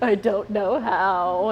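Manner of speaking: slow, drawn-out imitation of a whining child; mock helpless little girl; whiny daughter. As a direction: drag it out like a sulky kid. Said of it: "she'd be like".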